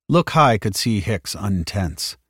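ordinary delivery, nothing marked

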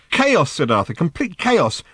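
high, dismayed exclamation; stunned. A narrator's reading. Arthur tells Ford what the news shows, high and dismayed. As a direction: cry it out, overwhelmed.

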